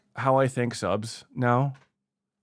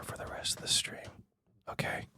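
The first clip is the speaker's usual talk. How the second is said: hushed, voiceless whisper; 15 dB quieter than usual. Pretend. Streamer obliging a chatter who said stop yelling, whispered. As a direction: drop to a whisper just for them. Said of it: "I'm gonna whisper".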